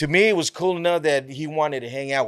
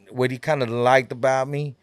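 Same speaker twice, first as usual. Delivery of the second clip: normal talking voice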